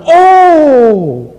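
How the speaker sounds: loud, high oh of discovery; shouted oh. Retold or imitated. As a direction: shout the oh as it clicks. Realisation shouted. ("oh" keras penuh kejutan)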